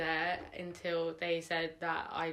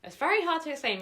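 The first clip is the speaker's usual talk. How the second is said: much higher, louder exasperated repeat; 11 semitones higher, 7 dB louder, faster than usual. Real, not acted. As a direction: throw up your hands: it's hard to explain. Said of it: "Maybe I'll have to explain it really fast".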